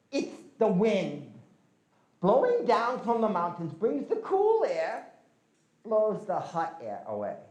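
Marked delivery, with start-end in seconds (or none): none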